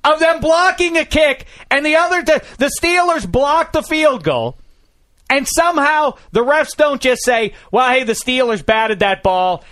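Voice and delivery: loud, high-pitched incredulous rant; exasperated, near-shouting disbelief; flustered outrage. Real, not acted. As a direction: rant at full pitch, hammering out every phrase. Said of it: "I'm sorry, I'm flustered".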